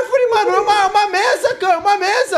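high loud laughing yell; panicked laughing. Real, not acted.

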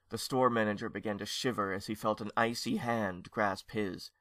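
usual speaking voice

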